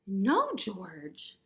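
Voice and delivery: loud, lightly voiced scolding mother; mother correcting. A narrator's reading. Reader as George's mother correcting her puppy, mild loud scolding. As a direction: lightly play a mother telling her pup off. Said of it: "said George's mother".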